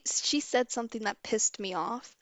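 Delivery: usual speaking voice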